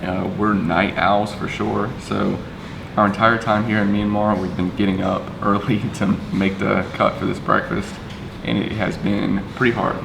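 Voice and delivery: usual speaking voice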